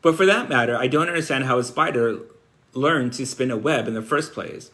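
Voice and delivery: animated storybook narration with lifted key words; story read-aloud. A narrator's reading. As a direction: read a children's novel to listeners. Reads Charlotte's Web aloud.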